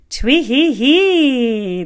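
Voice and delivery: slow, high chirping laugh "twee hee hee"; bird's high cackle. Acted. Gloating bird to the fallen hunter, high mocking laugh. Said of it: "the little bird laughed".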